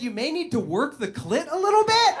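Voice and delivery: high, loud mom voice; teasing mom with a high, loud impression; mock mom. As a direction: tease the mom with a ham impression. Mocking mom's supposed advice.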